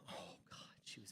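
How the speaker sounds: whispered, dreamy sigh of a phrase; whispered swoon. Real, not acted. Comic recalling the girl, whispered swoon. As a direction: breathe it out like she's still there.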